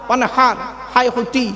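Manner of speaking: ordinary delivery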